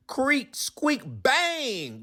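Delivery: high, voiced creak and squeak, then a bang; spooky house noises; creaky door noises. A narrator's reading. Sound effects in story.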